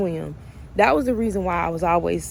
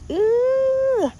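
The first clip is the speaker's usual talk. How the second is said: excited ooh. Real, not acted. Exclaiming about learning to swim.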